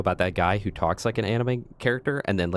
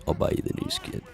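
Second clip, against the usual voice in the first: whisper. Acted. Whispered high bribe.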